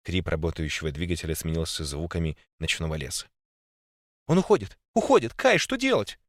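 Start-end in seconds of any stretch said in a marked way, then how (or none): 4.3-6.2 high, loud panicked cry. A narrator's reading. Girl sobs to Kai as Phil slips away, high panicked cry. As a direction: cry out in terror for help. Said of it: "Донёсся до меня женский плач".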